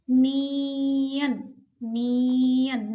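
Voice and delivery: नियन drawn out slowly, nasal held long at the end; teacher stretches नियन for learners to copy; slow model pronunciation. Shown as a demonstration. Teacher slowly models the Korean letter name for students. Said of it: "इसको क्या बोलते हैं?".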